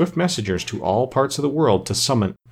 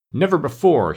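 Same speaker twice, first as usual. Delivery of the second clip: higher-pitched, restrained storybook rage; enraged cry; 6 semitones higher, 4 dB louder than usual. A narrator's reading. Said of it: "his voice trembled with rage".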